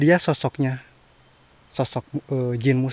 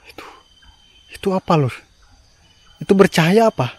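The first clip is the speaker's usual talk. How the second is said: scared horror game streamer; 7 semitones higher, 7 dB louder, faster than usual. Real, not acted. Hears sound, sees glowing thing.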